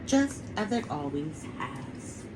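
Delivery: plain reading voice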